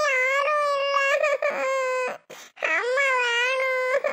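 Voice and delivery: put-on high, slow child's wail with sobs; crying child. Child begging aunt for absent mother, crying. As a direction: drag out the words in a high, tearful whine.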